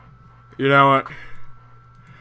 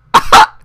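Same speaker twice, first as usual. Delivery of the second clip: short burst of laughter; 14 dB louder than usual. Real, not acted. Laughs at news headline.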